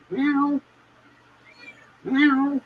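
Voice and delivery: high, soft meow back at the cat; imitating a cat softly.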